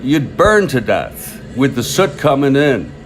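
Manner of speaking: lightly performed, emphatic recollection of a ballplayer; Smokey Joe Wood. Retold or imitated. Retelling a train-ride interview to listeners, emphatic quoted voice. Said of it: "in his sort of southern accent, I can't do it".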